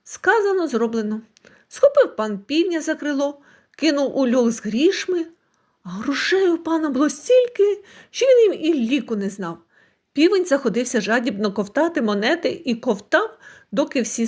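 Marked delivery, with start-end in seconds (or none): none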